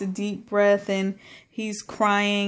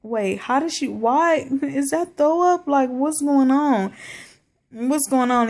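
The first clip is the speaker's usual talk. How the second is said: confused; 5 semitones higher than usual. Retold or imitated. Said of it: "I'm sitting there like".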